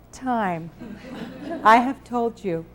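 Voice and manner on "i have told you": ordinary talk, nothing marked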